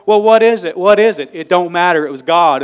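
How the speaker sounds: loud, fervent outburst; worked up. Real, not acted. Pastor stirred up before his church, loud fervent cry. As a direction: let the fire boil over. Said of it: "'cause I'm all worked up".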